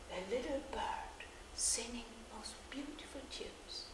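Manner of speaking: hushed whisper. A narrator's reading. Wonder as bird appears.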